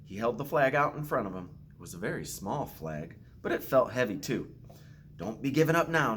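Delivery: put-on gentle storytelling read to children; story narration. Principal reading a picture book to students, gentle storytelling.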